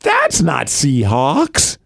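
very slow, rising objection; slow, drawn-out mock kid protest; imitating young daughter. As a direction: stretch it out like a doubting child. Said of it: "then she goes".

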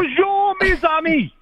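put-on high, sing-song Bonjour with a Boston accent; hammed-up French hello from a Bostonian; Boston guy playing French, high and bright; mock French greeting in Boston accent. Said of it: "I'd spot that Boston accent".

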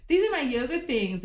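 high mocking influencer voice; mock Hilaria. Mocking a celebrity mom to friends, high and breezy. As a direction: lampoon her breezy yoga caption.

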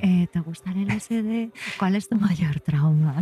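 lightly voiced sibilant psychiatrist; a hint of a hissing shrink. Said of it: "con una voz así muy sibilante".

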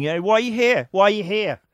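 high, pressing challenge to a newcomer; challenging greeters. Acted. Bank greeters swarming a customer who walks in, challenging. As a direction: accost the customer like a pack of greeters.

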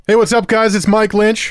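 big energetic show opener; loud intro. Real, not acted. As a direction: hype up the audience.

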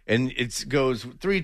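ordinary delivery

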